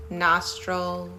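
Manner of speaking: ordinary talk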